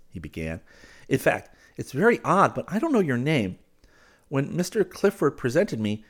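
apologetic, with emphatic pitch swings; earnest polite suitor. Acted. He asks a new acquaintance her name, politely earnest.

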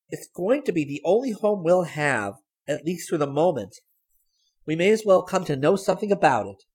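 put-on high, lively girl's voice; insistent Sally. A narrator's reading. Sally arguing with her sister.

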